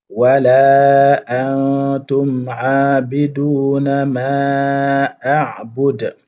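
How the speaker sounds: slow, drawn-out chanted recitation; Quranic recitation. Shown as a demonstration. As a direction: recite it slowly, stretching the vowels. Teacher recites verse as model.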